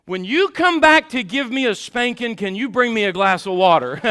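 loud retold kid's request, starting high; calling out boldly. Determined brother to mom about a drink, calling out. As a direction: call it down the hall, undeterred.

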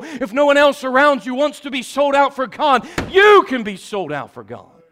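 loud, high, driving sermon voice; full-force preaching. Acted. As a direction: hammer each line at the congregation.

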